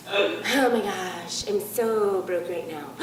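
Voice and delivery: low, slow, dragging mock lament; mock despair. Comic mocking new-thought people, low slow mock moan. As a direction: moan about being broke, hammed-up. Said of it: "new thought people will do this".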